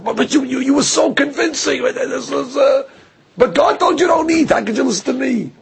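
high, stammering mock whine of the fooled victim; sneering parody of a gullible victim, pitched high. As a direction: mimic the victim's helpless whining. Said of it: "He says".

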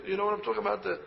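ordinary talk

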